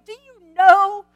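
a hammed-up affronted archangel; a mock rebuke from Gabriel; indignant angel. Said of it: "when he heard the word Gabriel".

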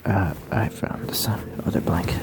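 soft unvoiced whisper; ASMR whisper. Whispering to ASMR listeners about stereo sound. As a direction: hush it close to the mic.

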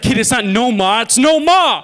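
loud Boston correction, climbing to a high "NO-MAH"; indignant Boston-accented shout. Acted. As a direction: correct the kid in broad Boston. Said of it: "He's like".

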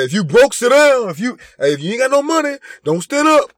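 high, loud mock DJ orders; scolding club DJ. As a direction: call out the broke customers like a club DJ. Mimics a DJ scolding broke customers.

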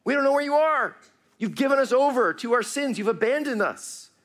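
lament to God. Acted. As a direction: voice the exiles begging God not to hide. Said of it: "they're talking to God".

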